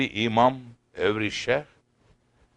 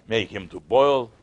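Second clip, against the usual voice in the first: plain reading voice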